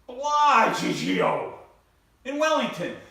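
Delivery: hammed-up proud announcement; proud Rocco. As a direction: savor Bellagio like it's paradise. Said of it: "He said".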